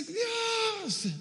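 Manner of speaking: desperate cry. Acted. Hagar alone in the desert, crying out desperately to God. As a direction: act out a desperate wail. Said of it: "desesperada, cla-, dice".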